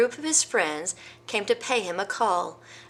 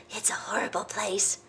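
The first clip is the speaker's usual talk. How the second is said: groaning gnu; 6 dB quieter than usual. Acted. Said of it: "said the gnu with a groan".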